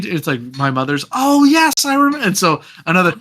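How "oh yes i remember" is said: high-pitched, gushing recognition; delighted recognition. Retold or imitated. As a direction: act out suddenly remembering someone with delight.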